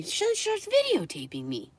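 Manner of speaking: very high, incredulous telling; outraged squeal. Retold or imitated. Storyteller recounting being filmed by dog owner, high incredulous squeal.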